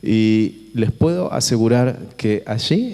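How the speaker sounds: normal talking voice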